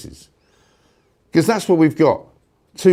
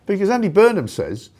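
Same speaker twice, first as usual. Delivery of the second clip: normal talking voice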